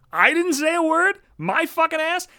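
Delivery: high, slow rising disbelief on "word"; stunned disbelieving echo; incredulous. Real, not acted. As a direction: echo "I didn't say a word?" as if it's absurd.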